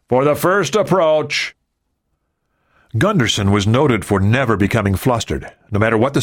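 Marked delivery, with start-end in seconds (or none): 0.1-1.5 high, slow call, restrained in volume; shouted. A narrator's reading. Nixon warns the deck crew, shouted. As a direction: call it out, stretching each word. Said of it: "Nixon shouted".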